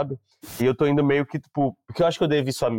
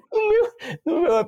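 words squeezed out high through laughter; cracking up mid-sentence; laughing falsetto; slower than usual. Real, not acted. Telling a funny story to friends, laughing high.

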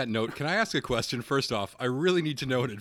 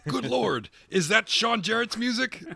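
wrestling commentator; 11 semitones higher, 4 dB louder, slower than usual. Pretend.

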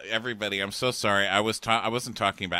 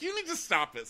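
much higher scolding squeal; mock scolding. Scolding the counselor, high mock squeal. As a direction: tell her off in a high voice. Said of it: "I'm like".

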